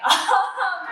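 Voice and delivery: short laugh through tears; teary laugh. Real, not acted. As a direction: laugh off the emotion. Embarrassed laugh after tears.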